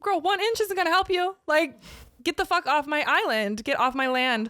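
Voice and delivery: high-pitched, sassy telling-off; mock indignant retort. Fan telling off her concert rival, mock indignant.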